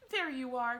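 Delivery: loud, bright call of discovery; cried out. A narrator's reading. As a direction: call it out to the group. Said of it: "cried Mister Wonka".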